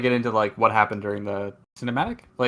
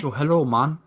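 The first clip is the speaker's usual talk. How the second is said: put-on Jamaican accent; 3 semitones higher than usual. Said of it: "you're just doing a Jamaican accent poorly".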